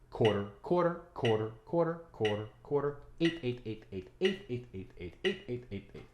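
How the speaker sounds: quick rhythmic count of quarters and eighths; counting rhythm aloud. Shown as a demonstration. Teacher demonstrates the rhythm by counting, chanted in time. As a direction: chant the note values in time. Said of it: "Usually this would be like a quarter note".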